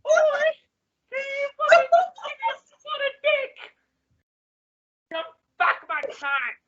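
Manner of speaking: high falsetto mock British queen; hammed-up high queen voice, slipping at the end; Queen of England. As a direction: act out the royal in a squeaky put-on accent. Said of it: "I lost the accent there".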